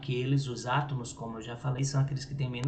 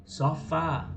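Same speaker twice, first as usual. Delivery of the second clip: sofá with a higher, strong final fá; stress on FÁ; 4 semitones higher, 3 dB louder than usual. Shown as a demonstration. Teacher showing the stronger syllable, stress on the fá of sofá. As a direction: hit the last syllable of sofá. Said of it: "ele é mais forte".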